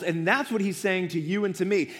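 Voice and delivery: usual speaking voice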